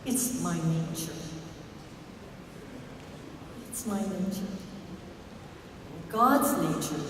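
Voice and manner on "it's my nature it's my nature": soft, lower, whispered excuse; scorpion's whisper. Acted. Scorpion excusing the bite, soft lower whisper. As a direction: breathe out the shrugging apology. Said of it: "And he says".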